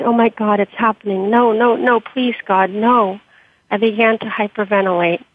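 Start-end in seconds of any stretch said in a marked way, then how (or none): none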